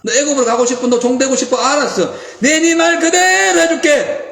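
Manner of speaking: mock them, then seal it; God answering grumbling Israelites; stern God taunting. God voiced taunting the people.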